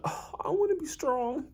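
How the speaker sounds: put-on dreamy kid longing. Ippo watches the fight tapes and longs to be strong.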